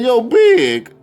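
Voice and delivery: high, loud mock exasperation; retold exasperated challenge. Fan confronting Big about his breathing, exasperated. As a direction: call him out, voice jumping up.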